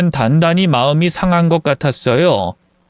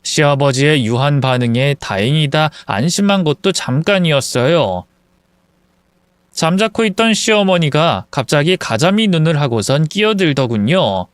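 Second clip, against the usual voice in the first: usual speaking voice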